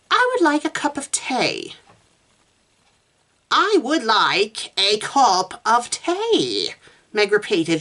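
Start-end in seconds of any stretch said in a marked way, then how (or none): 0.1-1.8 "I would like a cup of tea" pitched high at an easy pace; model sentence. A narrator's reading. Susan modelling "I would like a cup of tea" for Meg, high model. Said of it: "elongate your vowels". 3.5-6.7 very slow, high, stretched vowels; dragged-out words. A narrator's reading. Meg practising elongated vowels for Susan, dragged out. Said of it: "dragging the words out".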